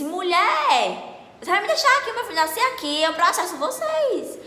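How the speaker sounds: high, loud outraged shouting; protesto aos gritos. Retold or imitated. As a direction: yell a threat at the nurse, words stretched at the end. (gritando indignada, em voz alta e aguda)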